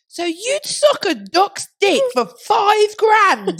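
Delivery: high, loud, slow mock question; dead loud. Asking her friend loudly in the hotel reception, dead loud. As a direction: belt it out for the whole reception. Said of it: "I said it dead loud".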